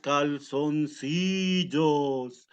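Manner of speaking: slow, drawn-out wolf. Acted. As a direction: stretch every word like a lazy wolf. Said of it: "dijo el lobo feroz".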